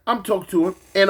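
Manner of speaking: usual speaking voice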